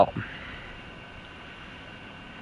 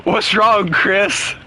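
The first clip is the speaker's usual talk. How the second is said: teasing; 8 semitones higher, much slower than usual. Real, not acted. Teasing the silent Chris.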